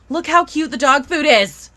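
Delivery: normal talking voice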